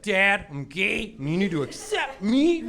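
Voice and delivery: high, slow, rising appeal to dad; mock-dramatic plea. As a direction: plead with dad in a hammed-up coming-out speech. Imagined coming out to dad.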